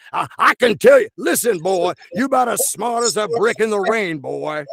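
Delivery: high, blustering Southern cartoon voice; stammering, folksy blowhard rooster impression; Foghorn Leghorn. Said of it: "Foghorn Leghorn".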